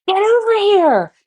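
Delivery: high, loud, frantic holler; panicked shouted command; urgent yell. Retold or imitated. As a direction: yell it in alarm, reenacting a dog attack. Acted urgent yell, against calm take.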